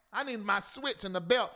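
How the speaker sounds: ordinary talk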